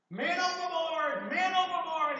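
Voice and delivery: high, full-voiced yell of alarm; man overboard shout. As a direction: shout it like a kid spotting someone in the water. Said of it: "he would be yelling at the top of his lungs".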